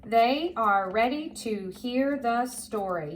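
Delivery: slow, word by word; careful, spaced-out read-along voice; model reading. Shown as a demonstration. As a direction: read it out slowly for a learner to follow. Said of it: "Now read it with me".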